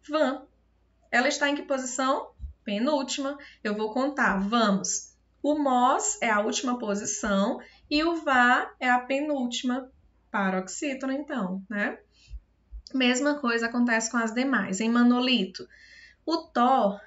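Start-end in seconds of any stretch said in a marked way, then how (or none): none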